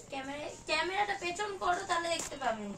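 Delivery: ordinary delivery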